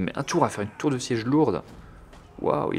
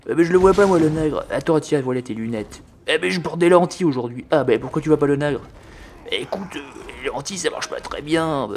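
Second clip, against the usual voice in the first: high, put-on comic crew voices; bickering soldiers; 5 semitones higher, 4 dB louder than usual. Onager crew arguing over glasses and lenses, comic bickering. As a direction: hapless gunner squabbling with his mate.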